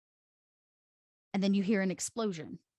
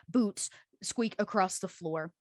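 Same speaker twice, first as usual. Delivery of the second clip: ordinary delivery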